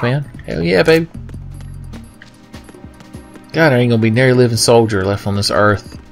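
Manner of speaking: usual speaking voice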